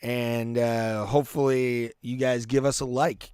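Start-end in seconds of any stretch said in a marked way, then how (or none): none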